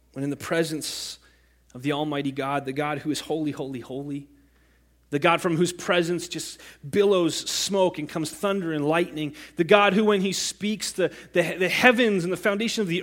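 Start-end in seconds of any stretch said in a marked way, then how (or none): none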